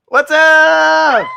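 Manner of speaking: loud, high, drawn-out holler; excited shouted greeting. Real, not acted. Host greeting a guest joining the chat, real shouted hello. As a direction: holler it out to welcome a friend.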